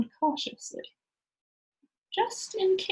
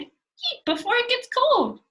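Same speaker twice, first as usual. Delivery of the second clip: a much higher, eager call; cried; 13 semitones higher, 6 dB louder, slower than usual. Acted. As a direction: sing out the order to eat. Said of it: "she cried".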